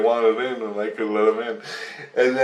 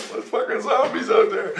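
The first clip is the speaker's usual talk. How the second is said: high-pitched, laughing it out; 13 semitones higher than usual. Real, not acted. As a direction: giggle through the line. Laughing at own dream.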